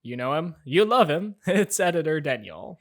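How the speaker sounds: loud presenter build-up; host introduction. Acted. Host introducing guest editor Daniel, loud presenter hype.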